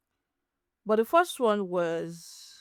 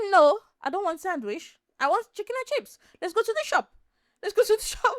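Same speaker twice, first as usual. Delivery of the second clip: much higher whiny mock beggar voice; demanding beggar imitation; 9 semitones higher than usual. Vlogger mocks a beggar refusing a sandwich, high whiny demand. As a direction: whine for chicken and chips like a spoiled kid, pitched way up. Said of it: "they'll say".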